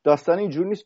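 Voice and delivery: high, surprised objection; retold protest. Musician protests to the man, high and startled. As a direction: jump in, startled, to correct him. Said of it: "گفتم".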